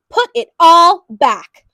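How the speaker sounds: slow, loud firm command; father scolding his daughter; stern order. A narrator's reading. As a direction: lay down the law.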